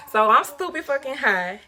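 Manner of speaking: ordinary talk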